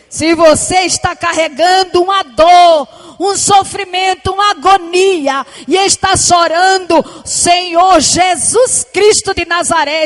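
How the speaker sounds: loud, building sermon shout; preaching at full force. Acted. Preacher promising the grieving an end to pain, loud. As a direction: drive each phrase harder to the cry.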